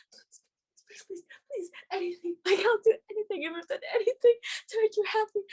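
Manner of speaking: imitating a frantic, pleading prayer; desperate praying. [name] begging during the pageant announcement, desperate mock praying. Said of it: "[name] was praying".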